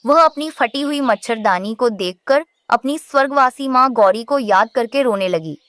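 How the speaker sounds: usual speaking voice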